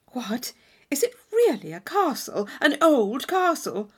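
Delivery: eager Catherine. Acted. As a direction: ask it wide-eyed. Catherine amazed.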